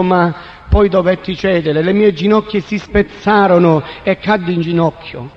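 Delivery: ordinary delivery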